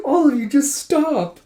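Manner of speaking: high, loud, drawn-out wail; exasperated plea. Acted. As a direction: beg the whole group to quit, at wit's end. Poor Gija's like.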